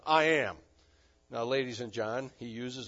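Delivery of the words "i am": "I am" said loudly and firmly; stress on I AM. Shown as a demonstration. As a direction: punch both words of "I am". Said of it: "The emphasis on".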